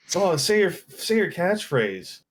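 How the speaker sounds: high-pitched, drawn-out mock fan request; eager fan. Fan asks him for his catchphrase, eager. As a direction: imitate a fan begging in a high voice.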